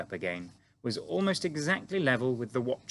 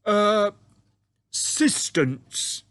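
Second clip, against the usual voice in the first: slow, proud giant voice, higher and louder; beaming giant; 11 semitones higher, 8 dB louder, much slower than usual. A narrator's reading. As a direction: say it like he's found the perfect word.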